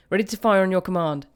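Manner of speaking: loud, eager readiness report; called out. A narrator's reading. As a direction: call it out to the commander. Gunner calling out to commander.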